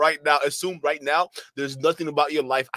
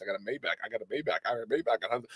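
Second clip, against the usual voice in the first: mocking braggart; 4 semitones lower, 8 dB quieter, faster than usual.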